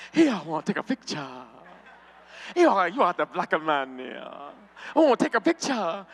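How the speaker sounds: imitating eager fans in a Chinese accent; mock Chinese accent. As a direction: put on a comic Chinese accent. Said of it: "they was coming up to me".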